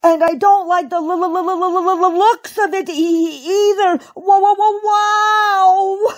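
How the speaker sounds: high stutter building to a long wail; stammering shout. A narrator's reading. Old rabbit nearing puddle, high panicky stammered yell. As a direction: sputter in panic, then howl the last word. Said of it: "shouted Uncle Wiggily".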